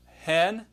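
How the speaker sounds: aspirated "hen"; rough breathing shown. Shown as a demonstration. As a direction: sound the h in "hen". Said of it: "notice the rough breathing mark".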